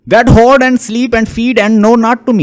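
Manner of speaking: slow, high-pitched verse recitation; poem reading. Acted. Lecturer re-reading Ulysses' line for the class, slow poem reading. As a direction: read the verse out slowly and high.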